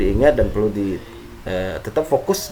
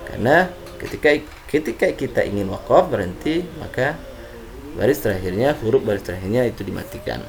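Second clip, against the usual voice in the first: ordinary talk, nothing marked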